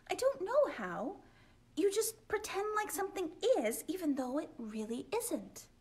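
put-on high little girl voice; lightly voiced young girl; cheerful Olive. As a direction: chirp it like a small girl. Olive voice explaining.

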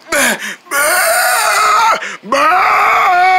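strained, cracking shout of bah; straining bleat. Acted. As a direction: force the bah out and fail. Goat tries to bleat loud.

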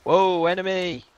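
startled shout. Real, not acted. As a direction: shout it out in surprise. Spotting an enemy in firefight.

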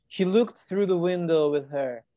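usual speaking voice

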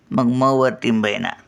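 ordinary delivery

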